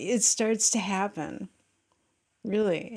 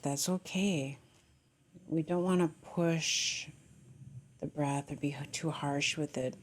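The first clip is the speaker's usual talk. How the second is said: guided meditation; 3 semitones lower than usual. Real, not acted. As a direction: gently settle them into their breath, lowering the voice.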